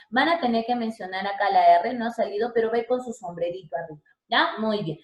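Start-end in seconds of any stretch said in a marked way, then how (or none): none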